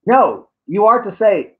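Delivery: usual speaking voice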